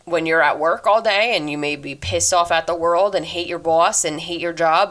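ordinary talk

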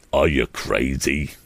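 low, slow, incredulous question; lightly voiced bully's sidekick; demanding crony. A narrator's reading. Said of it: "demanded Pete Bailey".

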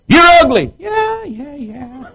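hammed-up shrill-voiced wife calling out; nagging wife. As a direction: squeal like a wife demanding attention. Acted marital quarrel, wife nagging.

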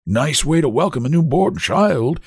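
high, aged grumble; weak complaint. A narrator's reading. Old-man baby complains to nurse, weakly. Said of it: "he complained in a weak voice".

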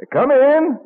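loud, high call to the door. Acted. Franklin calls loudly to whoever is knocking. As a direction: call through to the visitor.

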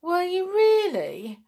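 high, loud, thrilled question; light up with amazed gratitude; excited boy, pitched right up; delighted surprise. A narrator's reading. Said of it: "his face lighting up".

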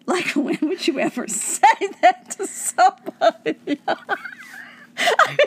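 laughing hard, voice breaking up; cracking up mid-sentence. Real, not acted. Cracking up at the story.

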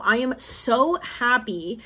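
normal talking voice

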